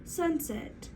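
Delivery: blended whole word. Shown as a demonstration. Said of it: "let's try it one more time".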